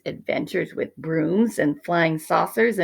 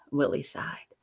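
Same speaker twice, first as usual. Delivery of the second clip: ordinary delivery, nothing marked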